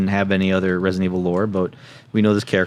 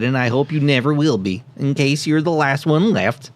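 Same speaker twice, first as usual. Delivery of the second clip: higher nerd voice with southern drift; nerdy scientist voice; 7 semitones higher than usual. Acted. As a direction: keep reading as the nerd. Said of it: "doing a southern accent".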